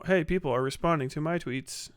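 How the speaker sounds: ordinary talk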